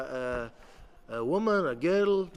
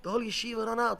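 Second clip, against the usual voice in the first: ordinary delivery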